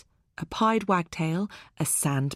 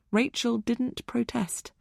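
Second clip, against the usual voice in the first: plain reading voice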